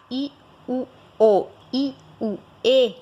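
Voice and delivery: I, U, O, I, U, E sounded out one by one, in a different voice; slow teacher demo of the vowels. Shown as a demonstration. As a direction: sound out each vowel in turn. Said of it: "eles são mais fracos".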